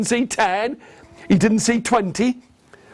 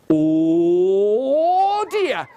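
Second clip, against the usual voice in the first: long "Oh" swooping up; dawning dismay. Acted. Wife realising her mistake, drawn-out rising dismay.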